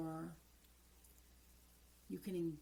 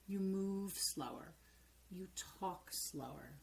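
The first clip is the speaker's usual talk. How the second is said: usual speaking voice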